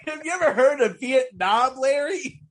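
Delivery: high, loud mock grilling; mock interrogation. Acted Walter line, laughing retelling.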